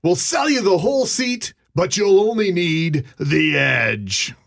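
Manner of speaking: imitating the Sunday Sunday Sunday ad guy; monster truck ad announcer. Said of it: "that infamous recording of the guy who says".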